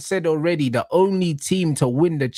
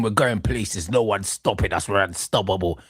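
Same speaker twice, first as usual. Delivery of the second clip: lower, mock-serious boast; mocking imitation; 8 semitones lower than usual. Fan mocking his friend's new confidence, lower and pompous. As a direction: put on a self-important rival fan. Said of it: "Now he's saying".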